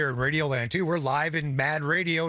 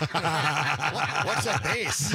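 laughing echo; much slower than usual. Retold or imitated. Host teasing the bassist's childhood question, laughing. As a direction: repeat the naive question while cracking up.

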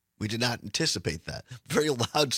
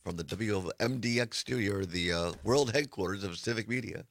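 announcer voice; 6 semitones lower, 5 dB quieter than usual. Retold or imitated. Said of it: "we love it when she says".

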